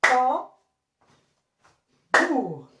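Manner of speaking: "Tam" lifted, long pause, "bour" falling; two separate beats, "Tam" then "bour"; syllable by syllable. Shown as a demonstration. Teacher starting over for pupils, syllable by syllable. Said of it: "Je recommence".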